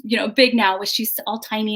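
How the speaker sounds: ordinary talk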